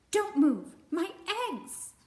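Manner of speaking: high, loud bird warning; robin shouting. Acted. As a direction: snap out a frantic warning. Robin pecks bear again.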